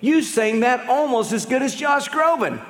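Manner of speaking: a lightly voiced chirpy fan; cheery backhanded compliment. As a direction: gush it like a friend who means well. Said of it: "I get this one all the time".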